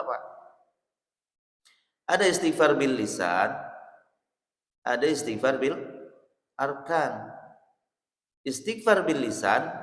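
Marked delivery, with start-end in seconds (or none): none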